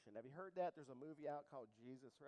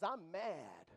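a touch of mock anger; acting out indignation; indignant; 10 dB louder than usual. Shown as a demonstration. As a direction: show them angry, loud. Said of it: "Indignant is".

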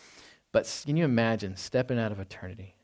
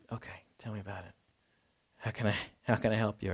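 gentle comforting father; 9 dB quieter, faster than usual. Retold or imitated. Father comforting child at night.

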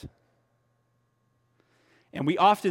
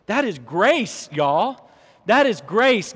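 preaching climax; 5 semitones higher, 5 dB louder, slower than usual. Real, not acted.

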